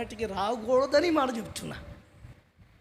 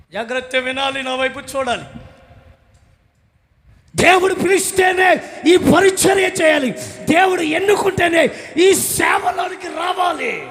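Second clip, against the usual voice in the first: fiery preaching; 9 semitones higher, 14 dB louder than usual. Acted. Preacher calling the congregation to service, booming shout. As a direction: proclaim it to the whole hall.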